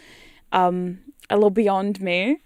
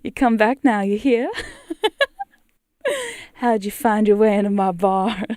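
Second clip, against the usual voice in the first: put-on southern accent; 4 dB louder than usual. Cowgirl welcoming caller to her barn, bad Southern accent. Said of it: "My southern accent is so shit".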